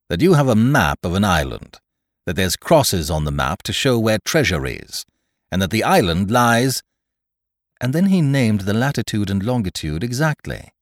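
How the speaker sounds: emphatic, building report; lightly voiced blunt captain. Captain reporting leaked secrets, lightly voiced and emphatic. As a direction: press each fact home.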